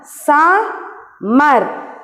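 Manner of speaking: "Summer" in two drawn-out beats with a pause between; split into syllables. Shown as a demonstration. Teacher showing first and second syllable, "Summer" split Sum-mer. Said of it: "this is first syllable".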